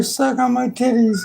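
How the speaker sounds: very high put-on kitten voice; the cat demands attention, very high. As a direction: squeak it out like the cat. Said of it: "she literally meowing".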